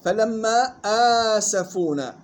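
slow آسفونا with long opening "aa"; two-count madd. Shown as a demonstration. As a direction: hold the first vowel of آسفونا. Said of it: "مد بدل مقدار حركتين". (آسفونا بمدّ البدل حركتين)

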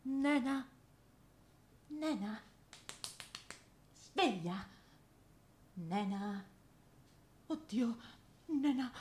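hushed, gentle calls to wake her; quiet, worried wake-up calls; soft calling. Acted. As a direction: murmur her name softly to rouse her. Waking a friend who won't stir.

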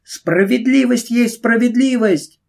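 bravely. Acted. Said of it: "храбро прибавила она".

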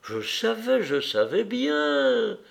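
put-on high little boy's voice, drawing out the last words; insistent child. A narrator's reading. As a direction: repeat it like a child who was sure all along. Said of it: "répétant sa phrase favorite". (voix aiguë d'enfant, insistante et traînante)

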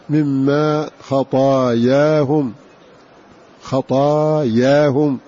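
خطاياهم very slow, both "aa" vowels held long; qadaya pattern. Shown as a demonstration. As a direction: stretch خطاياهم on the pattern of قضايا, "khataayaahum". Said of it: "على وزن قضايا".

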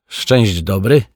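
ordinary delivery

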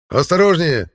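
high, loud shouted warning. Acted. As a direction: shout across the camp to warn. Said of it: "донёсся от костра крик конунга".

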